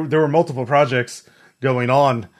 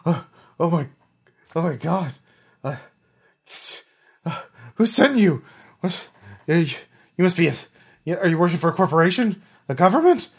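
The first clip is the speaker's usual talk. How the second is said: shocked panic; 6 semitones higher, faster than usual. Acted. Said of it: "falls back and goes".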